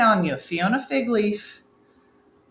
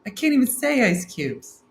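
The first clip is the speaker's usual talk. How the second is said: ordinary talk, nothing marked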